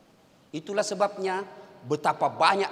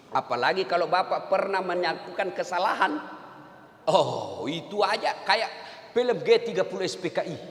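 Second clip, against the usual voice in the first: ordinary delivery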